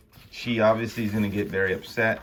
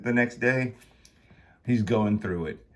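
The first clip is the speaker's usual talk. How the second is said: usual speaking voice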